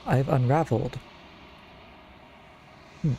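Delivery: usual speaking voice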